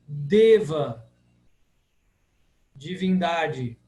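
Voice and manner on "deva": Deva with a closed E; loud, closed E in Deva. Shown as a demonstration. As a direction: firmly model Deva with E as 'ay'. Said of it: "sempre fechado".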